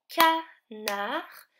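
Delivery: "Canard" in two separated beats, ca, nar; syllable by syllable. Shown as a demonstration. Teacher shows children the final syllable, "Canard" split in two. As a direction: pause inside "Canard" to isolate nar. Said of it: "finit avec la syllabe nar".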